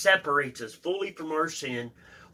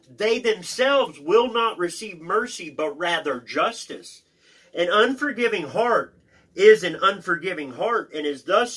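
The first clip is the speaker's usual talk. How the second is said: raised, deliberate teaching read; emphatic reading aloud; 6 semitones higher, 6 dB louder than usual. Acted. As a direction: read it out with weight on each key phrase. Reads commentary aloud.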